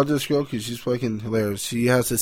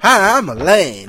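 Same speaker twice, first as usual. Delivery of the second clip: mock North Carolina accent; 7 semitones higher, 5 dB louder, slower than usual. Teasing his girlfriend about her accent, mock North Carolina accent. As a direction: exaggerate a North Carolina twang, slowly. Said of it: "this fucking North Carolina accent will come".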